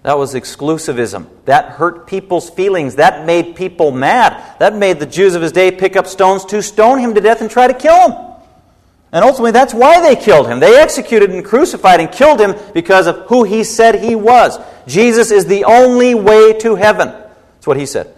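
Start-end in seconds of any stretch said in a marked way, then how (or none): 1.4-14.6 rising preaching. Acted. Preacher tells the congregation why they killed Jesus, building to fiery preaching. 14.9-17.1 ONLY hammered, louder and higher, falling at the end; emphatic proclamation. Acted. Preacher proclaims to the congregation the only way to heaven, full force. Climactic restatement of the claim.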